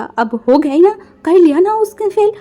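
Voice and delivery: high, loud bitter jab; angry blame. Acted. Father blames Wang for son's failure, loud and bitter. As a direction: hurl the blame at her. Grandfather blaming Wang loudly.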